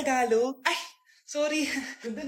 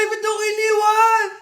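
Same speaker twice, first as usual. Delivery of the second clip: crying shout; 5 semitones higher than usual. Acted. Child left in the restroom wailing for her mother. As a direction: wail at being abandoned.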